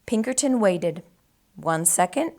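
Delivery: usual speaking voice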